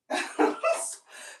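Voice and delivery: high, breaking-up laugh; real laugh. Real, not acted. Amused by her friend's curt greeting, real high laugh. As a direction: burst out laughing.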